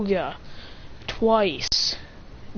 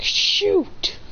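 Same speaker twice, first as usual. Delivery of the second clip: high, drawn-out exclamation; pitched-up mock distress; acted dismay; 11 semitones higher than usual. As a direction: blurt it like a hero who is hurt. Acted wounded hero in story.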